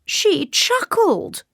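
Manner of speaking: high, drawn-out scandalised wail; high wailing royal complaint; king's cry. A narrator's reading. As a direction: cry it out slowly in outrage. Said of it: "cried the king".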